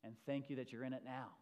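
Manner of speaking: low, soft, unhurried praying; quiet prayer. Real, not acted. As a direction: pray softly, with pauses between phrases. Leads a prayer.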